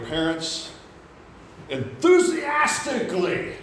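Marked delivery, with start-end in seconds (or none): 1.7-3.5 enthusiastically drawn out slowly, pitched high; heavy stress on enthusiastically. Real, not acted. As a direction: punch the word enthusiastically, stretching it out loud and high. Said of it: "I put the emphasis on that word".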